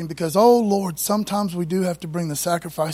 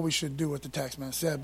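quiet aside; 7 semitones lower, 9 dB quieter than usual. Real, not acted. Quiet aside correcting himself.

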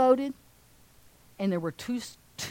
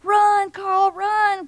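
high, loud shout urging him to run; loud cheer; 9 semitones higher, 5 dB louder, much slower than usual. Acted. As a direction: yell him home from the bleachers. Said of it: "they're saying".

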